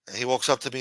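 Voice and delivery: ordinary talk, nothing marked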